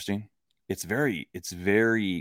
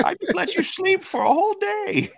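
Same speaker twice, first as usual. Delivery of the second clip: high mock Aunt May; 5 dB louder than usual.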